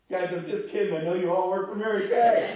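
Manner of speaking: loud, high shout to a crowd; calling out. Retold or imitated. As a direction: holler it down the length of the plane. Calling out to whole plane cabin.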